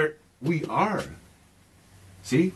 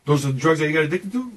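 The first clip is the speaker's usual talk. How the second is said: usual speaking voice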